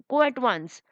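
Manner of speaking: ordinary delivery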